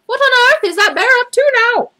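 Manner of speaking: very high, loud shriek of alarm; shocked cry. A narrator's reading. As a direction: housekeeper crying out in horror. Mrs Bird crying out.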